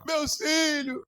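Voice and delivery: high, loud hammed-up wail; mock crying. Preacher acting soldiers who lost their families, mock crying. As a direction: bawl it out like a soldier pretending to sob. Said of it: "Imagina um bando de soldados".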